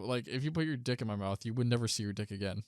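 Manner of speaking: ordinary talk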